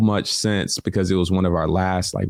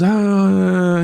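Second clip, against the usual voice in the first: mock dismissive ah; 12 semitones higher, 5 dB louder than usual.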